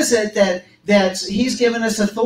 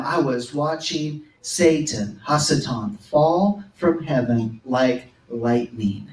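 solemn voice of Jesus; 6 semitones lower, 5 dB quieter, slower than usual. Acted. Jesus's words read solemnly.

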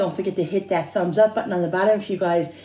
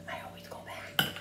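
unvoiced whisper, much softer; 16 dB quieter than usual. Shown as a demonstration. Repeating to viewers in a whisper. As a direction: breathe it out as a secret. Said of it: "Why am I whispering?".

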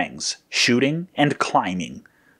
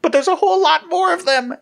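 very high-pitched panicky voice; squeaky-high frightened sidekick; alarmed cry; 3 dB louder than usual. A narrator's reading. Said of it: "Yellow cried".

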